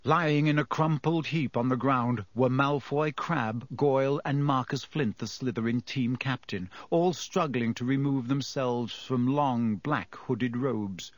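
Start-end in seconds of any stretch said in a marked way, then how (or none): none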